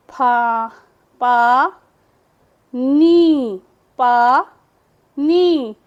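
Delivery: पानी broken into loud separate syllables. Shown as a demonstration. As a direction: sound out पानी piece by piece. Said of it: "जैसे कोई भी हम लफ्ज़ लिखेंगे". (पानी के हिस्से अलग अलग ज़ोर से)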